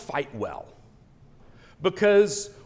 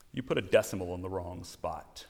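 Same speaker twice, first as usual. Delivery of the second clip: softer, lower and quick, dead calm; calmly; 7 semitones lower, 8 dB quieter, faster than usual. Retold or imitated. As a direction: deliver the blow with quiet composure. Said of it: "very calmly said".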